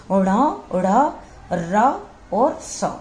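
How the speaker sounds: ण, ढ़, र, स drawn out slowly one at a time; retroflex demo. Shown as a demonstration. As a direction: hold each sound, ण ढ़ र स, tongue curled back. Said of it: "ये हमारे किस में आ गए? मूर्धन्य में".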